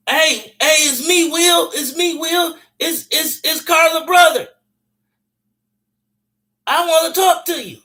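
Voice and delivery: pleading voice. Retold or imitated. Voicing Will at the door.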